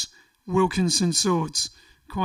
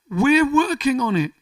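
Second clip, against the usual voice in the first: emphatic; 6 semitones higher, 6 dB louder than usual. Real, not acted. Insisting they are busy.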